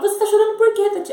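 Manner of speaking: loud, high, insistent questioning; retold agitated mother. Mother questioning her crying daughter on the phone, agitated and insistent. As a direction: demand an answer as a worried, rattled mother. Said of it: "Ela começou a ficar nervosa".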